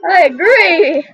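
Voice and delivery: excited. Real, not acted. Enthusiastic agreement.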